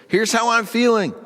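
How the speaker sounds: raw, honest outburst pitched high; strong honesty. Acted. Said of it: "very strong honesty that says".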